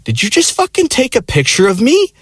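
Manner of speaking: very high, mock outraged female voice; high-pitched freak-out impression; imitating freaked-out girl. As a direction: squeal the accusation in mock indignation. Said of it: "the girl started freaking out".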